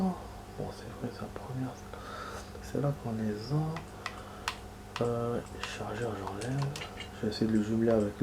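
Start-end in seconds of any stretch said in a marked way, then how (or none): 0.0-6.6 hushed whisper, barely any voice. Real, not acted. Gamer whispering to viewers while sneaking past enemies. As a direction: drop to a whisper so the game can't hear you. Quiet commentary during tense gameplay.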